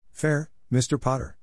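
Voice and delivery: a stern, clipped-off retort, lightly voiced; snapped. A narrator's reading. Teacher scolding a pupil, a touch of a snap. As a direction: snap the word back at him. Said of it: "she snapped".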